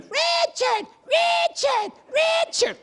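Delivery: very high squealed chant; high fangirl squeal; mock adoring women. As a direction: imitate excited women shrieking for their idol. Women cheering for their idol.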